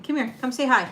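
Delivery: high, cooing call to the dog; coaxing pet voice. Real, not acted. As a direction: coax the dog over.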